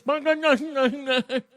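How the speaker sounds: babbling mock laugh for their noise; mocking gibberish laugh. Shown as a demonstration. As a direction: laugh out the nonsense they make. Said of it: "All we hear is this".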